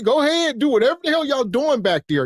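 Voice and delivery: high, fast brush-off, mocking the parent; mock dismissive parent. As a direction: wave the kids off like you can't be bothered. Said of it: "'Cause y'all like".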